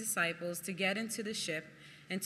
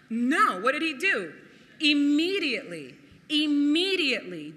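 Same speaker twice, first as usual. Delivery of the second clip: preaching force; 6 semitones higher, 9 dB louder than usual. Acted. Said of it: "preaching emphasis".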